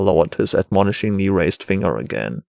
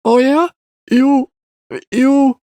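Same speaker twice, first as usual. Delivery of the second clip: put-on high, louder, halting boy; child hunting insults; 5 dB louder, slower than usual. A narrator's reading. Boy searches for his next insult, halting child voice. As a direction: grope for an insult in a high kid voice. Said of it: "as if the next insult would be found somewhere".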